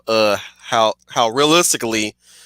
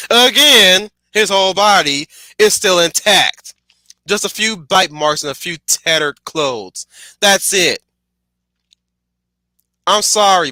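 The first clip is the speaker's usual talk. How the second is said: exasperated rant; 8 semitones higher, 5 dB louder than usual. Real, not acted. Ranting about the tame movie.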